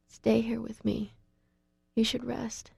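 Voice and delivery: whisper. A narrator's reading. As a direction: whisper him to rest beside her. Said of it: "I whisper".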